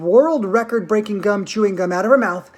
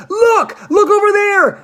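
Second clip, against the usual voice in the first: shrill scream, much higher and louder; 14 semitones higher, 7 dB louder, slower than usual. A narrator's reading. Veruca screaming to everyone across the river. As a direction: scream it out, pointing wildly. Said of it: "she screamed".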